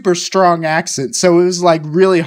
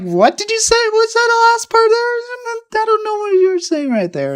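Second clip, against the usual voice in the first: very high, squeaky mock confusion; baffled reaction. Student failing to follow teacher, high-pitched bafflement.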